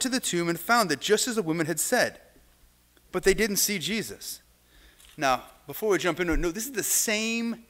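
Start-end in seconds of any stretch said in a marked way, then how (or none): none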